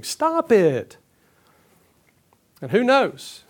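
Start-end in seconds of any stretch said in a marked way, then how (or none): 0.0-1.0 higher, exasperated command; acted indignation, not shouted; incensed. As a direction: put your foot down, mildly incensed. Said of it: "He's just incensed over this whole thing".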